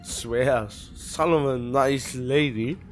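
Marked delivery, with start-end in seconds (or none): none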